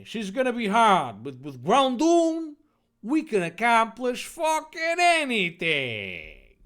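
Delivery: hammed-up heroic shout; rousing boast. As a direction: proclaim it like an overblown anime hero. Dave boasts about his Pokemon.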